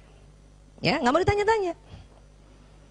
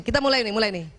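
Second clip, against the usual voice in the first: usual speaking voice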